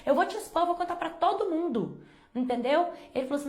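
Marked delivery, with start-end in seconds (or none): 0.0-2.0 high indignant retort, pitch up throughout; retold angry outburst. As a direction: fire back in disgust, pitch way up. Angry retort to harassing uncle.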